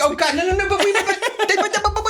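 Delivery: high, stammering mock panic with laughter; mock dismay. Band pleading with a crowd that is leaving, mock dismay. Mimics band watching audience leave.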